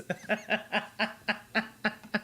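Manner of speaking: giggling real laugh. Real, not acted. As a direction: giggle at the joke. Laughing at city of Butts.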